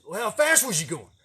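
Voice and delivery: high, loud insistent question; angry dad asking; demanding father. Retold or imitated. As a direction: press the boy for the truth. Father pressing for answer.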